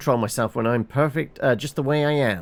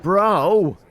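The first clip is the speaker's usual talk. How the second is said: loud, higher outraged "Bro!"; protest shout; 7 semitones higher, 6 dB louder than usual. Acted. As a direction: yell it at your sibling in outrage. Sibling protests the refusal.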